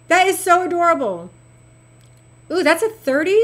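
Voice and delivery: ordinary talk, nothing marked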